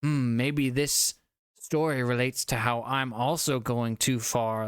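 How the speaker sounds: slow, musing hmm of a ship captain; mock pondering captain. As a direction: drag it out like a captain lost in thought.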